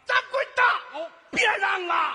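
high, loud mock call; mimicked helper's cry. Shown as a demonstration. Vendor shows when to come in, mimicked high cry. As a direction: imitate the helper's shrieked plea. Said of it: "那会儿你才来".

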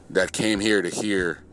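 ordinary talk, nothing marked

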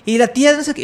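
high, sing-song Sinaloa mother's voice; Sinaloa accent. Retold or imitated. Sinaloa accent, the mother answering about her kids, high. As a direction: lilt it high like a mom from Sinaloa. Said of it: "hablan así con este contexto de allá de Sinaloa".

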